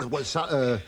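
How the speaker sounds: usual speaking voice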